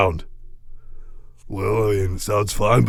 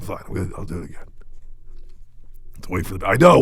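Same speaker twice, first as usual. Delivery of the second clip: ordinary talk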